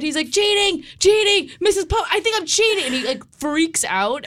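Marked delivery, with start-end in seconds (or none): 0.3-2.8 wysoki, spanikowany głos SpongeBoba; wykrzykuj, jakbyś wpadał w panikę; upset panic. Retold or imitated. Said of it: "he gets so upset".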